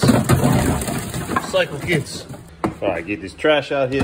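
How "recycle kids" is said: slow whisper. Shown as a demonstration.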